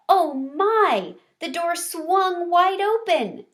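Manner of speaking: high, loud gasp of wonder; breathless with amazement at the door; gasping surprise. Acted. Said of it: "Gigi gasped".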